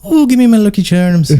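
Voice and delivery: high-pitched cartoon Irish; hammed-up Irish leprechaun lilt; Irish accent. Friend mocking Irish before the Scottish contrast, high Irish accent. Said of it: "I'm Irish".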